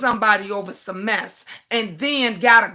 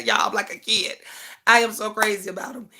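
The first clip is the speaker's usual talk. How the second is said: ordinary delivery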